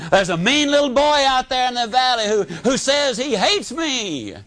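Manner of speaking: lightly voiced frightened child running to mom; scared little boy. A narrator's reading. Frightened boy tells his mom.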